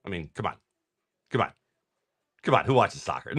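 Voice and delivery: ordinary talk, nothing marked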